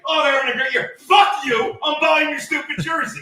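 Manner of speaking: loud, sarcastic ranting fan; mock angry fan. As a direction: shout it like a bitter fan. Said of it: "You didn't say".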